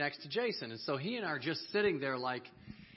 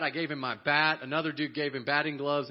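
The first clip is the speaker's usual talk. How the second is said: ordinary delivery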